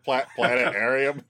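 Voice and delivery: planetarium with the T dropped; speech impediment. Retold or imitated. Host imitates cartoon guy's speech impediment, planetarium without T. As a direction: stumble into planetarium, skipping the T. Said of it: "the T sound in planetarium".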